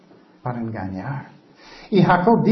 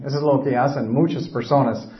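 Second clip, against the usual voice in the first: ordinary talk, nothing marked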